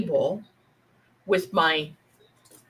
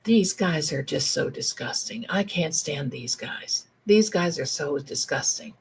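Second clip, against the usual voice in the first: low, mock-disgusted complaint; grumbling women; 5 semitones lower, 4 dB quieter than usual. Women sitting together about the men, low mock disgust. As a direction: imitate aunts muttering their disgust, pitched low. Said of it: "They would be saying".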